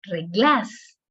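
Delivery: "reglas" with absurd stress on GLAS, rising; wrong last-syllable stress. Shown as a demonstration. Teacher showing an absurd error, "reglas" wrongly stressed on GLAS. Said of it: "No puede decir".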